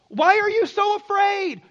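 high, ringing challenge; loud rebuke. Acted. Jesus waking to frightened disciples, loud rebuking question. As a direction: demand it loudly, pitched well up.

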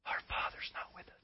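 very soft, unvoiced-sounding whisper; mock dying-wish whisper. Brothers invoking dead dad's wishes, solemn whisper. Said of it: "you know Dad's dying wishes were".

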